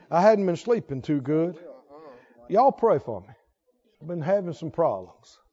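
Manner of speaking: low, downcast mock complaint; mock weary complainer. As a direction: moan it low, asking for sympathy. Mock complainer before bedtime.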